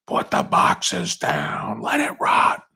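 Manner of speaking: slow, strained shouting union boss; Hoffa film impression. Rallying union men in the film Hoffa, slow strained shout. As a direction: holler it slowly, straining the voice. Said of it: "You guys like the film Hoffa".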